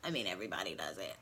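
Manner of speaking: deep creaky vocal fry demo. Shown as a demonstration. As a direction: let the voice crackle into a low fry. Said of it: "We talk on a vocal fry".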